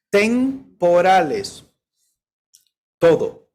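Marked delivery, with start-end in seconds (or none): 0.1-1.6 "Temporales" stretched very slowly, each syllable held; drawn-out answer. Shown as a demonstration. Preacher answering his own question to the congregation, slow stretched "Temporales". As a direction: draw out "Temporales" as the punchline. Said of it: "son, ¿qué?".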